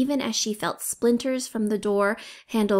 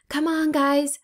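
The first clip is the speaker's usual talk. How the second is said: higher call from above; calling yell; 5 semitones higher than usual. A narrator's reading. Coco yells down from the hayloft, calling out. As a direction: call the boys up the ladder. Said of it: "Coco yelled from above".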